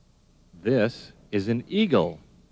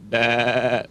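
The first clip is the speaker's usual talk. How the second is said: goat bleat; 3 semitones higher, 4 dB louder than usual. Pretend.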